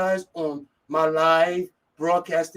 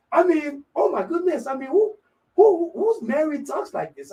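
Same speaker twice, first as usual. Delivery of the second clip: high-pitched, incredulous exclamation; high, scandalized disbelief; 9 semitones higher than usual. Real, not acted. Indignant at what actress said.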